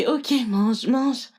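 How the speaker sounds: usual speaking voice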